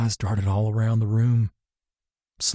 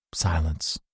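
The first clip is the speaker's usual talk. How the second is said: ordinary delivery, nothing marked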